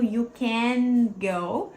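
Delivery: "can" with a neatly closed n, words not linked, very slow; slow careful demo. Shown as a demonstration. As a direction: close the n cleanly. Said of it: "A gente só fecha esse N bonitinho". ("or you can go" bem devagar, N fechadinho)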